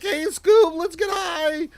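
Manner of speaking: high, loud cartoon stoner voice; Shaggy impression. Voicing a chat joke as Shaggy. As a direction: play it like a goofy cartoon slacker.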